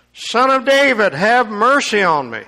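high, loud cry for mercy; desperate cry. Acted. Blind Bartimaeus crying out to Jesus, high and loud. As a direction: call out desperately for help.

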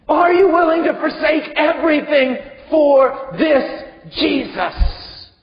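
full-force sermon appeal; fiery preaching. Real, not acted. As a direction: press the listener with fervor.

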